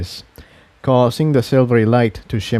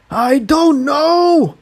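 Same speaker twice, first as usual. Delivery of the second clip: shouted; much slower than usual. Acted. Jigsaw shouts back while magic grips his horn. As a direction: shout it out in panic. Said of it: "Jigsaw shouted in response".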